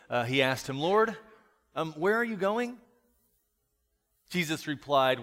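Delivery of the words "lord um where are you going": lightly voiced Peter, higher pitched; Peter's questioning. Disciple questioning Jesus, higher anxious-to-know question. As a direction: ask as Peter, pitch lifted. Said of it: "Here's Peter. He says".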